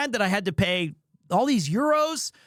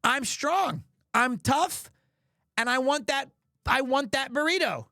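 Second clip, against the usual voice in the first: higher-pitched mock determination; mock tough boast; 5 semitones higher than usual. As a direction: puff yourself up, comically resolute. Mock boast in battle of wills.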